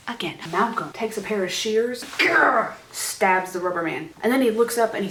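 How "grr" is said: high, loud mock stab growl; stabbing noise. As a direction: imitate the shears plunging in with a growl. Imitates the stabbing with shears.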